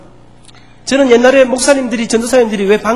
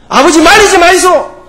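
louder, slow defiant reply; stubborn refusal; 7 semitones higher, 6 dB louder than usual. Acted. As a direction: dig in and refuse your father. Stubborn son refuses father.

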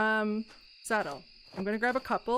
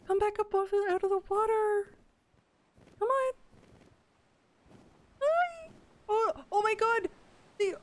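cutesy animal talk; 11 semitones higher than usual. Real, not acted. She calls the orcas out of the water in a high cutesy voice. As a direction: coo at them like they're puppies.

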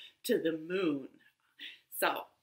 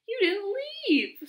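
very high, delighted squeal of words; happy shout; 14 semitones higher than usual. A narrator's reading. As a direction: exclaim it with joy, way up high. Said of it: "Squirrel shouted happily".

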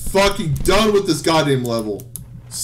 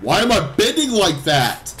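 raised voice, pitch swooping up; exasperated outburst. Real, not acted. Repeats it, exasperation boiling over.

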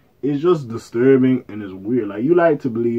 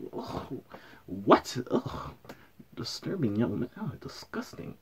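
disgusted groan; 14 dB quieter, slower than usual. Real, not acted. Recoiling in disgust.

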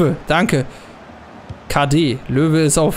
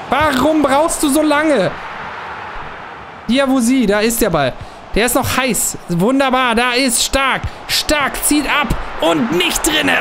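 excited play-by-play; 6 semitones higher, slower than usual. Acted. Player urges his striker on like a live commentator. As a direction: cheer the attack on like a live caller.

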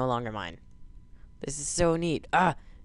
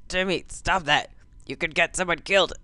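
ordinary talk

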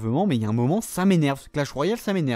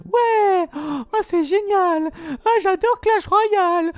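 much higher, louder, slower mock delight with sob-like breaks; sarcastic fake joy; 3 dB louder, slower than usual. Angry gamer mocks Clash Royale for viewers, overdone high fake glee. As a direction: gush like a child in a toy shop, dripping sarcasm.

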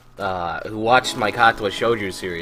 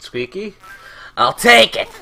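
eager villager voice; 8 semitones higher, 6 dB louder than usual. Acted. Voicing game villager.

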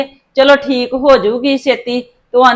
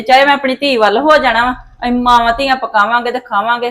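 ordinary talk

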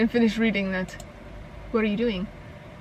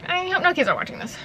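higher, quick put-on repeat; 6 semitones higher, faster than usual. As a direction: say it high and fast, hamming it up. Repeats own line in higher voice.

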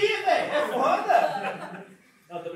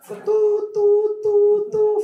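busy tone; 9 semitones higher, 9 dB louder, much slower than usual. Retold or imitated. Hang-up tone imitation.

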